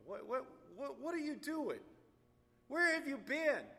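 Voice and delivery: loud, high, furious demand; retold angry outburst; outraged yell. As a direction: scream at the late rescuers. Angry at late rescue squad.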